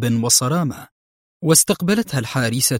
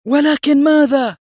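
high, loud angry shout; shouted, impatient challenge; screamed in her face; 14 semitones higher, 6 dB louder than usual. A narrator's reading. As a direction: cut her off with a piercing demand. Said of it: "صرخت في وجهها".

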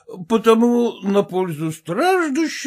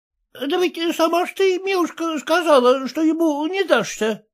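self-justifying; 7 semitones higher than usual. A narrator's reading. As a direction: make excuses to the wife, timidly. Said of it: "оправдывался муж".

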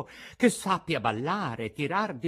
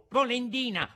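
very high name-calling jab; taunting; 12 semitones higher, 3 dB louder than usual. A narrator's reading. Carpenter taunts the old man with his nickname, high jeer. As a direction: fling the nickname back, high.

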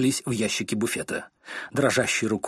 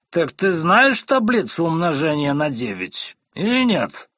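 slow, much higher and louder; thundering; 5 semitones higher, 5 dB louder than usual. Acted. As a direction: thunder the question down. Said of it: "прогремел мистер Корнер". (медленно громогласно гремит)

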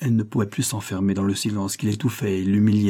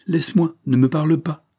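pleading outburst; 7 semitones higher, 6 dB louder than usual. A narrator's reading. Daughter pleading with angry father.

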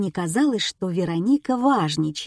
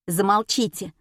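plain reading voice

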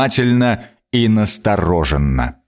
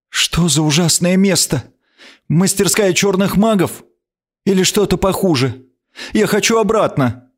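a queen's voice pitched higher, scared and demanding; haughty frightened queen; 10 semitones higher than usual. A narrator's reading. Stranded queen demands to be taken back home. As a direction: sound like a lost royal on the edge of panic.

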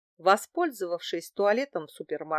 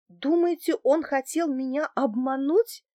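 high, louder, trembling question; 6 semitones higher, 3 dB louder than usual. A narrator's reading. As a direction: ask in alarm, pitch climbing. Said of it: "дрожащим голосом спросила я". (дрожащим, высоким голосом)

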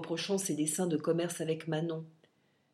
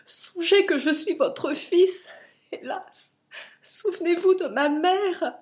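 tearful pleading; 8 semitones higher, 5 dB louder than usual. A narrator's reading. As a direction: plead with a breaking, sobbing voice. Fils implore son père en pleurs. (supplication en larmes, aiguë et forte)

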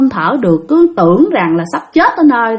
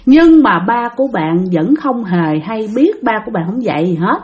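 normal talking voice

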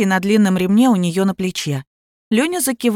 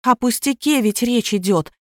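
ordinary delivery, nothing marked

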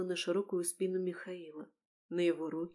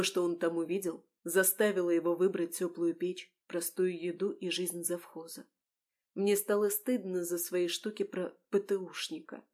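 usual speaking voice

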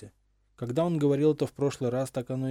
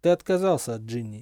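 usual speaking voice

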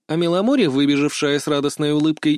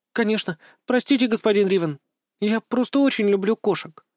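high little doll voice; apologetic doll; 7 semitones higher than usual. A narrator's reading. Doll apologises for chasing the cat. (виновато, кукольным голоском)

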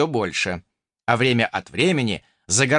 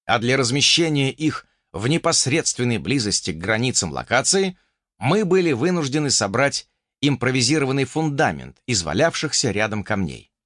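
ordinary delivery, nothing marked

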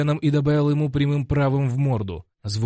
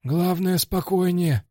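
coach's high-pitched character voice, urging calm; high-voiced coach; 7 semitones higher, slower than usual. Coach steadies his fighter before the next exchange, high-voiced coaching. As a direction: speak as the coach in a much higher voice.

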